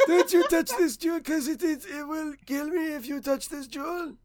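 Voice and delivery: cartoon voice. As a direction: squeak it out like the cartoon, then hush. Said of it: "how many times I've watched that".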